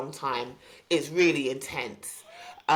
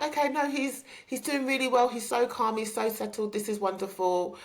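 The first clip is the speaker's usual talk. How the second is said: put-on high, bright sing-song praise of the baby; lightly imitated upbeat clinician; cheerful audiologist; 7 semitones higher than usual. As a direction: coo over the calm baby like a chirpy nurse. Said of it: "she was just like".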